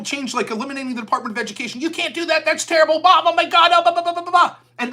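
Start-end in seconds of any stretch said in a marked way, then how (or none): 1.8-4.6 higher mock outrage trailing into blah blah; mocking whiny critics. Mocking critics who defend the Department of Education, higher whiny mock. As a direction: squeal it like a pearl-clutcher.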